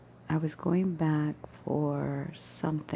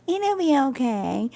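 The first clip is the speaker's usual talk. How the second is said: higher, a bit louder mocking echo; mock quote of Will; 9 semitones higher, 5 dB louder, slower than usual. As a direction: throw his words back at him. Throwing Will's words back.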